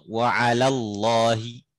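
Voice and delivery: ordinary talk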